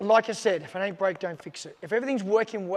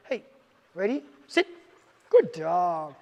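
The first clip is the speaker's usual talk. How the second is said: louder cheerful command and praise; upbeat praise tone; 6 dB louder, slower than usual. Shown as a demonstration. Trainer shows the praising tone, upbeat and louder. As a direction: sound pleased with the dog. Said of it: "That's the tone I want".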